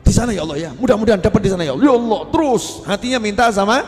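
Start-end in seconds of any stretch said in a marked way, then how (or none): 0.1-2.2 loud, insistent begging for money; desperate pleading prayer. Acted. Worshipper loudly begging God for money in his heart. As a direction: beg God over and over.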